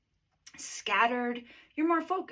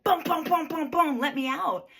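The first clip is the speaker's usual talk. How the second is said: loud, higher pounding booms; banging to get out; 6 semitones higher, 8 dB louder than usual. Retold or imitated. Carbon dioxide banging on the wall, demanding release. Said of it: "banging on the wall".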